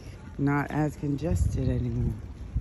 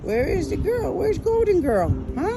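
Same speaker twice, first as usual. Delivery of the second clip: high sing-song voice for the birds; talking to birds; 13 semitones higher, 3 dB louder than usual. Real, not acted. Woman cooing at a dove at her feeder, high and playful. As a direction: coo at the dove like a pet. Calls the golden bird.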